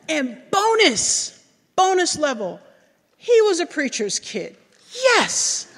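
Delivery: high, loud cheering; excited. Real, not acted. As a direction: celebrate like you won a bonus. Excited about preacher's kid.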